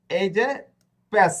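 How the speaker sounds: ordinary delivery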